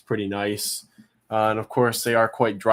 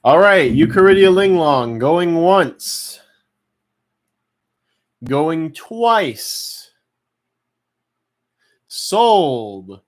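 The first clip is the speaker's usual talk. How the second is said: slow, loud auction call; auctioneer; 5 semitones higher, 9 dB louder, much slower than usual. Real, not acted. Live roach auction calling bids.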